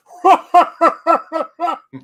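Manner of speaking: high, loud burst of laughter. Real, not acted. Telling friends about the clerks, loud laughing burst. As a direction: let a big laugh out.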